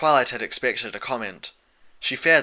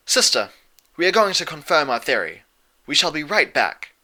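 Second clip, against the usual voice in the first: louder, lightly voiced royal sister; a lightly voiced princess, decisive; Luna; 5 semitones higher, 6 dB louder than usual. A narrator's reading. Luna telling Celestia she'll confirm the theory. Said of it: "Luna said".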